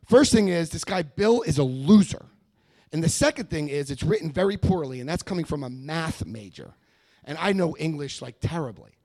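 loud dismissive rant. Retold or imitated. Arguing with his sponsor.

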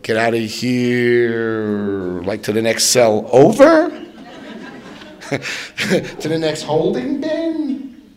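slow, disbelieving, breaking into a laugh; can't believe he's letting me go; drawn-out stunned question; incredulous. Retold or imitated. Incredulous reply to cop.

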